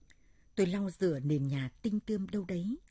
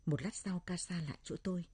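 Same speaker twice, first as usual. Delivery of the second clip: usual speaking voice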